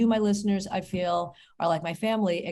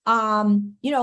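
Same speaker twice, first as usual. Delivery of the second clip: ordinary talk, nothing marked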